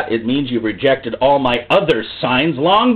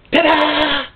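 high, drawn-out fanfare; showy high-pitched flourish; triumphant ta-da; 10 semitones higher than usual. Acted. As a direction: present it like a stage magician. Imitates triumphant show-off in street.

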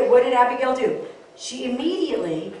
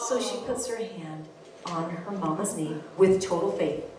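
ordinary talk, nothing marked

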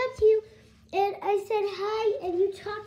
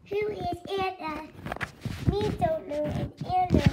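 stiff, word-by-word robot; monotone robot voice. Acted. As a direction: deny it like a machine. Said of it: "I am a robot".

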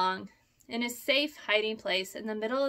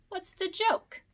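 high, sharp demand; 10 semitones higher, slower than usual. A narrator's reading. Peter demanding why the possum laughs. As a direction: insist on an answer. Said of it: "he demanded".

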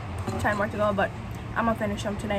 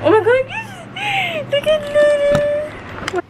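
excited squeal. Real, not acted. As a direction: squeal with joy at the food. Overjoyed at the sandwich.

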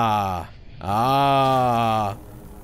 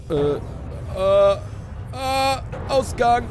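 panicked; 8 semitones higher, 3 dB louder, slower than usual. Real, not acted. Rushing for the exit in panic.

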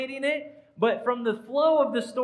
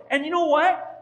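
ordinary delivery

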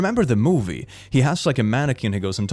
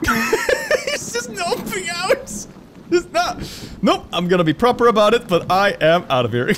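laughing through the words, high. Real, not acted. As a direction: giggle it out as it flees. Laughing at fleeing creature.

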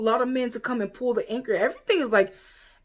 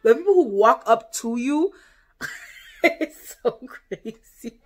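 usual speaking voice

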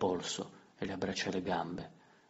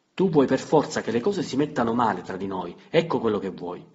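louder, higher, hurling the blame; angry accusation; 4 semitones higher, 10 dB louder than usual. Acted. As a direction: throw the charge at her. Litigio di coppia, accusa. (accusa rabbiosa, voce alta)

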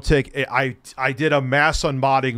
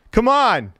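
taunting yell; 9 semitones higher, 5 dB louder than usual. Real, not acted. Repeated taunt.

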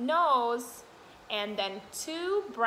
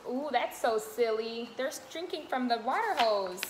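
ordinary delivery

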